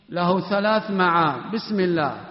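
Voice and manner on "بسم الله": ordinary delivery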